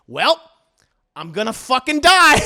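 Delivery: loud, high cry of doom; despairing outburst. Retold or imitated. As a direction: wail it, sure he's finished. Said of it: "And Dio's like".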